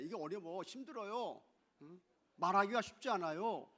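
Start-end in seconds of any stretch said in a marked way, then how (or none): none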